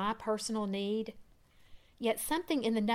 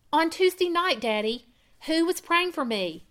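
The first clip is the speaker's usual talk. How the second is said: high, louder insistent questioning; cross-examining; 7 semitones higher, 4 dB louder than usual. Acted. Daughter pressing her father for the truth, insistent. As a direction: demand the answer like a lawyer. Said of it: "like that of a cross-examining attorney".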